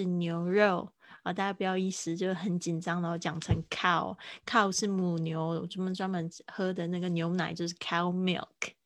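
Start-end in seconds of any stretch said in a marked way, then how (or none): none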